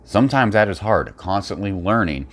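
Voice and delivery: ordinary talk, nothing marked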